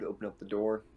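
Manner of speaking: usual speaking voice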